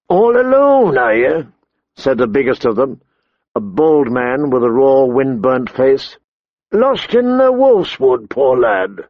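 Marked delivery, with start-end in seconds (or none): 0.1-1.5 taunting brigand. A narrator's reading. Brigand to lost boy, taunting. 6.7-9.0 high mock pity, falling at the end; mock the lad with false sympathy; taunting brigand. A narrator's reading. Brigand finds boy lost in the wood, mock pitying.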